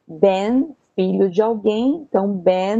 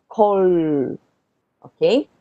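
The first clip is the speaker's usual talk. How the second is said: ordinary talk, nothing marked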